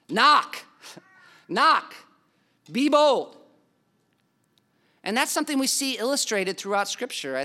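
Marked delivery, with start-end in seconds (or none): 0.1-3.4 loud, high urging: knock, be bold; pressing the congregation, louder and higher; emphatic exhortation. Real, not acted. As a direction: call it out like a rallying cry. Preacher urging bold prayer.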